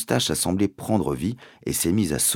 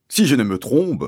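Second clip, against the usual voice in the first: loud, higher, pompous sergeant; police sergeant swapping P and B; 7 semitones higher, 6 dB louder than usual. A narrator's reading. Sergeant resuming his inquiry, pompous, P and B swapped. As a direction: make "trompe" end in a B. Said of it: "remplacer les P par des B".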